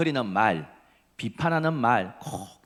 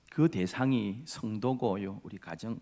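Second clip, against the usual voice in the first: ordinary talk, nothing marked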